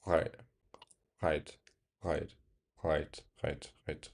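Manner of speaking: quiet, low "Right" repeated, the r curled; demo of right. Shown as a demonstration. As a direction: softly repeat "Right" with the tongue drawn back.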